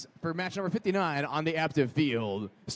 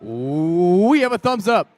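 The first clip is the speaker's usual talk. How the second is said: countdown call; 7 dB louder, slower than usual. Real, not acted. Match countdown.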